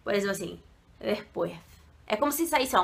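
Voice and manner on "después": "después" with a slack, softened D; lazy D. Shown as a demonstration. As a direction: say "después" low, as if too lazy for the D. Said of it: "parece que tá preguiçoso".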